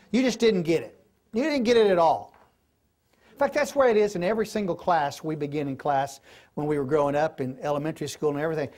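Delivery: ordinary delivery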